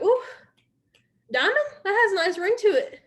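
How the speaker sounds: high, loud, delighted ooh; feeling good. Pretend. Recruiter imagines reaching diamond rank, playfully thrilled. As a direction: relish the new title with playful excitement. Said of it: "That feels good".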